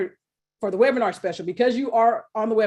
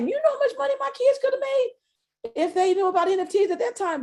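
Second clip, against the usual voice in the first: higher exasperated exclamation; 11 semitones higher than usual. Retold or imitated. Retelling her own exclaimed complaint.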